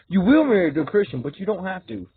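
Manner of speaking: imitated command, punching WILL and backing off right after; firm, then permissive. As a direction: boom out WILL, then lighten up. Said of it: "That's how he said it".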